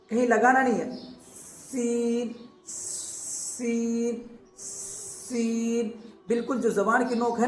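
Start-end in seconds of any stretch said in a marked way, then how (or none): 1.7-5.9 سین with a long whistling hiss on the s, drawn out slowly; سین said slowly with a sharp sibilant hiss; hissing seen. Shown as a demonstration. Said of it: "سیٹی جیسا مارو".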